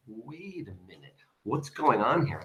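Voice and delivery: very soft, hushed puzzlement; dawning realization. Acted. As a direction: murmur it as suspicion slowly dawns. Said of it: "he's realizing".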